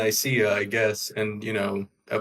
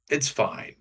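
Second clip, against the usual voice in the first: ordinary talk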